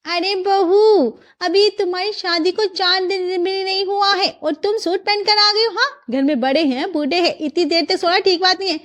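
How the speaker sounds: high-pitched indignant scolding; mother-in-law flaring up. Acted. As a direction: draw out the call, then scold. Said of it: "उसकी सासू माँ भड़क जाती है".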